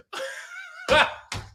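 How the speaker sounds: a sudden squeal of laughter; real high-pitched laugh. Real, not acted. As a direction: burst into a high giggle. Laughs at the text message.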